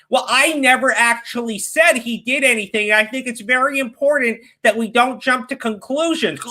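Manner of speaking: high, prim mocking voice; mocking prissy commentator. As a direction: sneer like a fake-careful YouTuber. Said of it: "You're gonna go".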